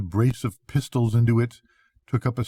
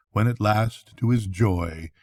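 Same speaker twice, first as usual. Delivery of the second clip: ordinary delivery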